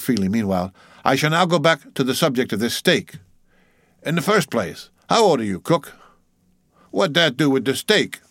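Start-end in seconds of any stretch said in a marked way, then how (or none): 1.1-5.9 lightly voiced interrogating mate, pitched up; mock-stern mate. Mate questioning old cook about the steak, mock-stern. 6.9-8.2 higher-pitched testy retort in dialect; old cook's irritated comeback. A narrator's reading. Old cook answering the mate's question, testy.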